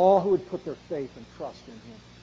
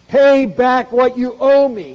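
slow, loud, higher demand for payment; angry demand; 6 semitones higher, 12 dB louder, slower than usual. Retold or imitated. Servant choking his fellow servant, demanding payment loudly. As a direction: spit it out at him, slowly. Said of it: "he demanded".

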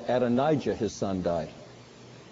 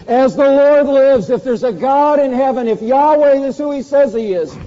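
much louder and higher, swearing to heaven; outraged oath; 9 semitones higher, 9 dB louder than usual. Acted. David swearing by Yahweh against the thief, loud outrage. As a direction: swear it with burning indignation.

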